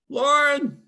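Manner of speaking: loud, high call across a room; calling from far away. Real, not acted. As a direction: shout the name to reach her. Teacher calling an absent student.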